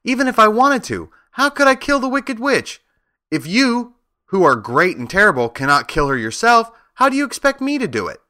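put-on high, pleading little-girl voice rising in distress; tearful pleading. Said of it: "she sobbed".

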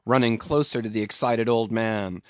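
ordinary delivery

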